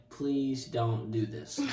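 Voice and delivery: slow, understated pleading; retold plea. Begging the youth not to introduce him, slow retold plea.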